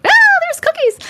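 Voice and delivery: high, loud mock shriek of fright; mock scream. Streamer mocking a story's scary cookies, high mock scream. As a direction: squeal in pretend terror at cookies.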